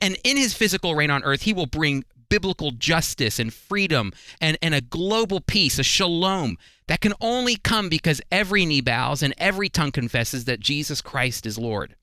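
ordinary delivery

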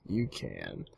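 very low, quiet mock-solemn "You can.". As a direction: drop way down low and soft. Repeated even deeper.

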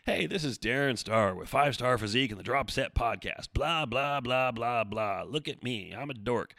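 hammed-up cheesy voicemail greeting; mock your own corny podcast greeting; self-mocking, cheesy announcer patter; cheesy recording. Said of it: "this really cheesy recording from me".

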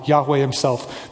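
usual speaking voice

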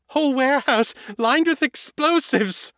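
very high, fast panicked gabble; vampire in full panic telling Kate the news; panicked rush. Acted. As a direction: spill it out in terror. Morgan in panic.

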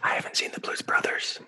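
whispered little aside. Real, not acted. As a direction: hush it, almost a confession. Quiet aside among friends.

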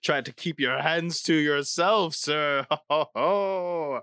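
hammed-up French cabaret host; teasing French-accented welcome ending in a chortle. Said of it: "I hate this accent so much".

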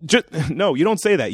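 ordinary delivery

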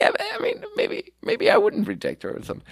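high, soft, hesitant mimicry of a butthurt friend; mock butthurt. Teasing Dave over a rejected girl, mock butthurt. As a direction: imitate a sulky friend in a high little voice. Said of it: "you got a little bit butthurt".

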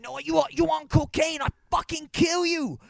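high-pitched mock furious shout; going ape shit. Stripper accusing customer of cocaine, high mock rage. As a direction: imitate an enraged stripper, pitch shooting up. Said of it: "she starts going fucking ape shit".

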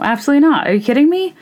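high, indignant disbelief; incredulous. Real, not acted. Host reacting to the sexist question, indignant disbelief.

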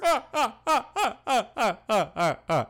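high, loud staccato ha-ha; forced laugh. Acted. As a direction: laugh big at the pun. Laughing again at the pun.

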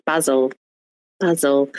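short British A in BASIL, "bazzle"; mock British pronunciation of BASIL; British accent. Shown as a demonstration. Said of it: "when Brits say, like, basil".

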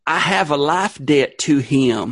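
put-on Southern accent; Southern character voice. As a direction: play it as a drawling Southern character. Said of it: "I love that voice".

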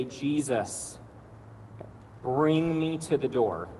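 slow prayer on the in-breath. Shown as a demonstration. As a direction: draw it out on one breath. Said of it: "As you breathe in, you say".

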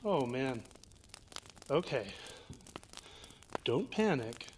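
soft, nervous whisper-talk; scared and hushed. Real, not acted. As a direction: hush it, trying not to panic. Frightened friend whispering in the dark.

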